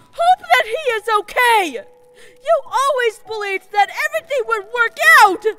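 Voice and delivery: loud, high, pleading shout; shouting through frustration, begging her not to give up; desperate shouting. Acted. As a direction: yell at her to snap out of it. Queen shouting at despairing friend.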